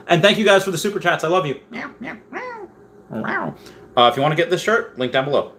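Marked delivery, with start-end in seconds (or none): 1.7-3.5 very high, soft meowing; cat meows. Pretend. Saying goodbye to viewers with tiny high cat meows. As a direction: mew like a tiny kitten.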